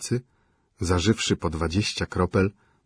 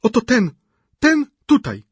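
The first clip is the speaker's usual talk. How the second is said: pleading widow; 8 dB louder than usual. A narrator's reading. Szczukina voiced by reader.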